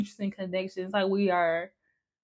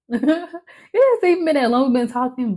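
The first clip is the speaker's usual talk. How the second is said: laughing; 4 semitones higher, 6 dB louder than usual. Real, not acted. Laughing through emotion.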